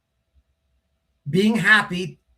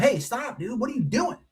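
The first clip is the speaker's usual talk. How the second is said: breaking up fight; 8 semitones higher, 3 dB quieter than usual. Retold or imitated. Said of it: "I'm like".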